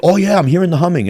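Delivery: high, quick put-on eagerness; mock pretending. Mimicking pilgrims who claim they hear humming, pretending. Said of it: "pretending to be touched".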